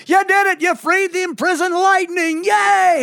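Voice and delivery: high, gleeful proclamation; big mock-heroic hurrah; excited cheer. Acted cheer for lit flame.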